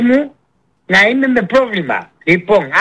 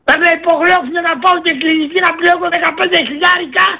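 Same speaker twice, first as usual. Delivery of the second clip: angry shouting; 5 semitones higher than usual. Real, not acted. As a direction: shout out the cost in outrage. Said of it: "Μη φωνάζεις, θα ξυπνήσεις το μωρό". (φωνάζει αγανακτισμένα)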